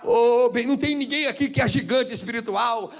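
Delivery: loud, high, impassioned sermon; fiery preaching. Acted. Pastor preaching loud to the church, impassioned. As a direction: preach it hard to the congregation.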